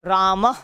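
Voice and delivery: राम said as "raamah"; teacher's demo of राम ending in "-ah"; visarga pronunciation. Shown as a demonstration. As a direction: finish राम with an h breath. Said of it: "ऐसे करके".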